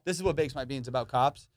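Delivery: ordinary talk, nothing marked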